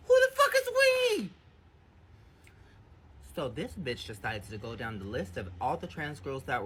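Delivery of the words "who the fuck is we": very high, loud, furious demand; outraged shout. Retold or imitated. To the friend who kept the secret, a furious shout. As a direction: explode at the word we.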